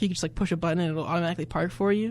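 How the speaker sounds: ordinary talk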